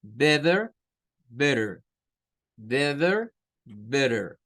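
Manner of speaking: American "better" with T flapped like R; American accent. Shown as a demonstration. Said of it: "acento propio del norteamericano". (acento norteamericano, better con T como R)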